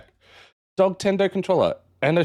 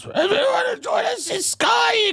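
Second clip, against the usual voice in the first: voice cracking. Real, not acted. Inviting Ingrid to Sky as the voice cracks. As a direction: voice cracks up into a far higher shout. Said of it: "What happened to my voice there?".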